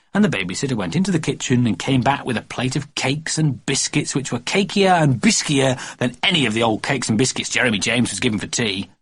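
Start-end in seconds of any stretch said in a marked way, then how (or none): none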